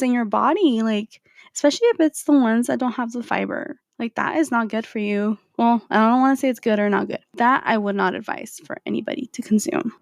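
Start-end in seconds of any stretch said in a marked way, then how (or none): none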